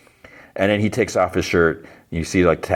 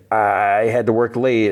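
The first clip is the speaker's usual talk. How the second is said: stalling excuse; 3 semitones higher than usual. Retold or imitated. Daughter making excuse to mom.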